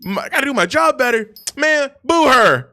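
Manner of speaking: rally the kitchen against her; outraged cook. Retold or imitated. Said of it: "And he's like".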